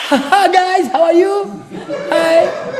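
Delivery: put-on very high, loud squeaky baby voice with a laugh; hammed-up baby saying hi; mock newborn greeting. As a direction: play the cheerful newborn, high and bright. Mimics newborn greeting world, laughing.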